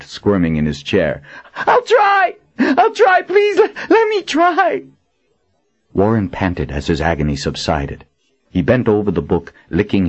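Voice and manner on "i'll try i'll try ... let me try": high, loud desperate cries; crying out. A narrator's reading. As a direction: cry out in agony. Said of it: "Warren cried out".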